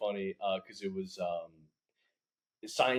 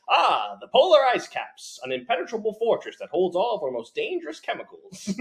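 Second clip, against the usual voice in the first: booming retro infomercial announcer; 1950s infomercial; 10 semitones higher, 6 dB louder than usual. Retold or imitated. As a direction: pitch the ice caps like an old TV ad. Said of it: "nineteen fifties, like, infomercial being like".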